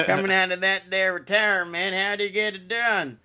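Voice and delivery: put-on a jokey Southern accent; Southern twang. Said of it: "sounding like Larry the Cable Guy".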